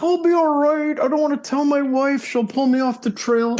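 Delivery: hammed-up hiker brushing off a hernia; imitating Wolfman. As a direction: pitch it up and play it brave. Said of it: "He's like".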